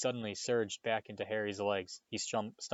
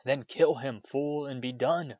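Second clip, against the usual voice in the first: screech; 4 semitones higher, 4 dB louder than usual. A narrator's reading. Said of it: "screeched Voldemort".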